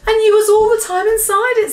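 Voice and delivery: high, loud, appalled exclamation; horrified cry. A narrator's reading. BFG realises Sophie was inside, horrified. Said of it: "said the B F G cried".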